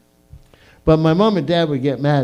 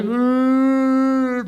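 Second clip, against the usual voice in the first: mock crying groan; 8 semitones higher than usual. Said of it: "I start crying".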